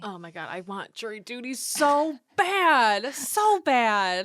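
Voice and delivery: loud eager gush; excited. Real, not acted. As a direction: gush with longing, loud and slow. Eagerly wanting jury duty.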